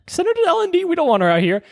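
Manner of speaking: very high, sharp mock of a snippy nurse; a medic mimicking a catty nurse; mock bossy nurse. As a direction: parody the staff brushing her off. Mocking the nurses' refusal.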